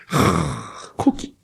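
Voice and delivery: quiet grimacing groan over the meat; mock pained groan. Preacher mimics a gloomy Jesus grimacing over meat. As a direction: wince through it like it hurts. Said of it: "이러면서 막 인상 짓는".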